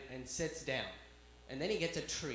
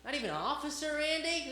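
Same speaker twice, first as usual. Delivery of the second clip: mock indignation; 9 semitones higher than usual. Mock indignation at dog's name.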